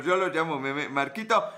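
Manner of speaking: ordinary delivery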